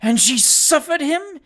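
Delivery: loud, high, slow indignant question; scandalised exclamation. A narrator's reading. Old lady appalled at her daughter, exclaiming. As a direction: exclaim as an outraged old woman. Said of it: "exclaimed the old lady".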